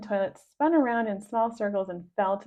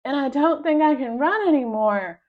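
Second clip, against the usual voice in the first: out of breath; 5 semitones higher, 8 dB louder than usual. A narrator's reading. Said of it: "huffing and puffing".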